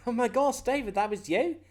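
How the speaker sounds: high, excited character voice; amazed recognition. Acted. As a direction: act out astonished recognition of a friend. Acted reunion with Toucher.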